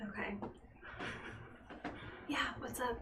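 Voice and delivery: soft whispered reply; whispering. Acted. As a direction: whisper back in the dark. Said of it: "Sleepover voices".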